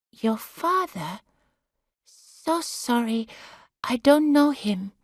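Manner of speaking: a hint of a flustered Filipino carer; apologetic carer. A narrator's reading. Corrie apologises to Sarah about her father.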